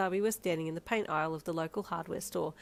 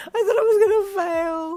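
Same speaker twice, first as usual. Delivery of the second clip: loud wail; 15 dB louder than usual. Acted. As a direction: wail it through tears of relief. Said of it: "you wailed".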